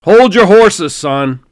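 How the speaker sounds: loud, high booming voice of a general. Acted. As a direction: boom it down the phone line like a hearty general. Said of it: "More booming".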